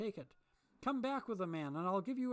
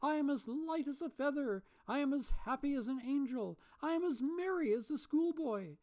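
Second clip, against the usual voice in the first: high, loud, whooping; giddy joy; 11 semitones higher, 5 dB louder than usual. A narrator's reading. Scrooge greeting the world, giddy whooping shouts. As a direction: shout with delirious delight.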